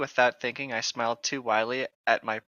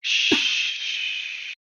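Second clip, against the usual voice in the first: giggly, slow breathy whisper; mock hush; 13 semitones higher, slower than usual. [name] hushes Tyler in his hospital bed, giggling whisper. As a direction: hush him like a secret lover, barely holding back laughs.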